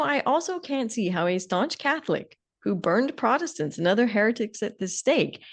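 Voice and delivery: usual speaking voice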